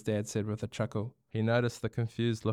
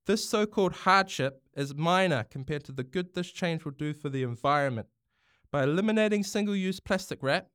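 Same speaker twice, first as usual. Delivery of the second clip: higher, rousing parliamentary address; passionate speech; 8 semitones higher than usual. Acted. Prime Minister addressing Parliament, a passionate speech. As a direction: argue for the planet with conviction. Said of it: "The Prime Minister's passion was clear".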